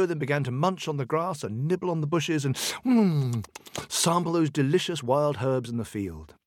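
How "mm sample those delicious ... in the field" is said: ordinary delivery, nothing marked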